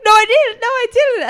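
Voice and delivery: frantic denial. Retold or imitated. Little girl denying she swore, high loud protest. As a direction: deny it loudly like a caught kid.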